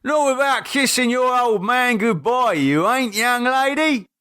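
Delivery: a hint of a dad calling. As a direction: call her back jokingly. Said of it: "Keith leaned sideways and called".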